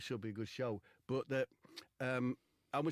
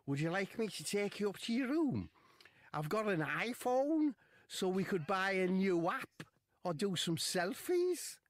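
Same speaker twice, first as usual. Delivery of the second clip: footballer's voice; 10 semitones higher, 5 dB louder than usual. Retold or imitated. Wade voiced high.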